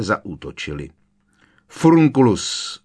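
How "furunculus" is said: shouted spell, higher and louder; yelled spell. A narrator's reading. Harry yelling a curse at Malfoy, loud shout. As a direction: hurl the curse with a yell. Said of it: "zaječel Harry".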